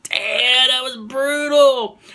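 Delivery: high, drawn-out mock amazement; awed reaction. Fan replaying his reaction to the exploding Minotaur, awed exclamation. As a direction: stretch it out high in reenacted awe. Acts out own reaction to gory kill.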